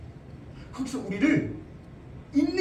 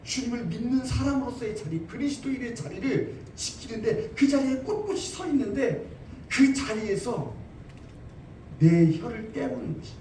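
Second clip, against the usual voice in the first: ordinary talk, nothing marked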